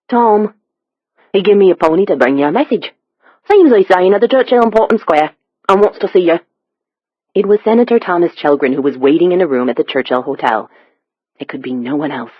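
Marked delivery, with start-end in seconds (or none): 0.1-6.4 higher-pitched Cockney messenger; Cockney accent. A narrator's reading. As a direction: rattle it off in thick London Cockney. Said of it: "The stranger's Cockney accent was nearly impenetrable".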